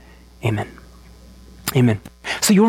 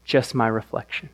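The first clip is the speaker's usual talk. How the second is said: dejected echo; 8 semitones lower, 5 dB quieter than usual. Retold or imitated. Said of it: "That's what he says".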